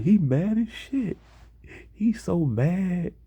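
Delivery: very high mocking squeal of words; teasing girls. Girls egging on a roast in class, high teasing voice. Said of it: "two girls next to him laughing as well".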